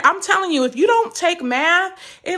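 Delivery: ordinary delivery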